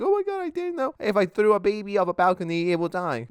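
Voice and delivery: very high, hammed-up clueless voice; mock innocence. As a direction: play dumb in a squeaky mock voice.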